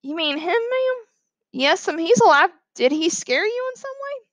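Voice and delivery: put-on high, gentle little boy voice. A narrator's reading. Boy asks scared teacher about it, gentle high voice. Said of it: "he said gently".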